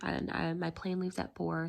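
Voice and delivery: normal talking voice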